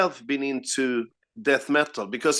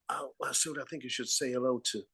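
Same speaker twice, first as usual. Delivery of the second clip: much softer, quick hushed tip-off; hushed aside; 3 semitones lower, 11 dB quieter than usual. Retold or imitated. Bandmates quietly tipping him off, hushed and quick. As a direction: murmur it fast as a discreet hint. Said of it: "[name] and [name] went like".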